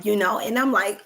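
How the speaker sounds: ordinary delivery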